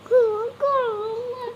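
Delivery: crying refusal. Real, not acted. Sulking child cries at mother, tearful whining. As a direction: wail out the refusal.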